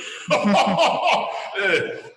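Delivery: Santa chuckle. Acted. Santa laughs at swimsuit joke.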